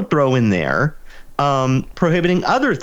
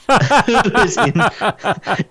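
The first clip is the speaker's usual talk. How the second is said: laughing through the words, higher; 8 semitones higher, much slower than usual. Real, not acted. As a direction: giggle while getting it out.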